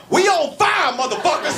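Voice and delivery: high, yelled warning to the room; mock yell. Line cook yells to the whole dining hall, high mock yell. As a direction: holler it at the diners.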